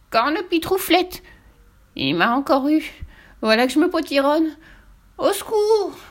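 high panicked witch wail; moaning cry. Acted. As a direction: wail for help as you shrink. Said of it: "gémit la sorcière dans un cri étouffé".

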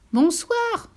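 high bright call. Acted. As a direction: sing out a cheerful goodbye. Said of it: "cria la goutte d'eau".

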